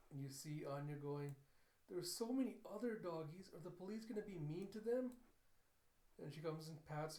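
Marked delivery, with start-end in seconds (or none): 1.9-5.2 put-on higher, pleading child voice; worried little girl. Child asks about the other doggies, worried pleading. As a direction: plead like an anxious kid, pitch lifting at the end.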